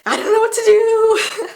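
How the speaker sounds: high, loud, hammed-up helpless cry; mock helpless panic. Lone worker facing an angry customer, mock panicked wail. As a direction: imitate an overwhelmed worker wailing. Imitating overwhelmed worker under pressure.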